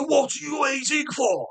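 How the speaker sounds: high-pitched mock demand; mimicking an annoyed older woman; impatient stranger.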